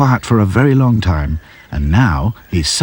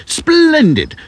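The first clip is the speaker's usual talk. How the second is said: bubbly. A narrator's reading. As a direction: burst with delight on splendid. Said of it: "bubbled the professor".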